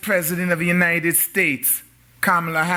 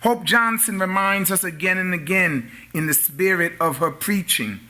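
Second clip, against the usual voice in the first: usual speaking voice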